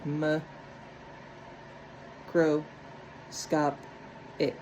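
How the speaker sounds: m, cro, scop, ic said apart with pauses; split into syllables. Shown as a demonstration. Teacher to class, sounding out syllables. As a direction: tap out each syllable separately.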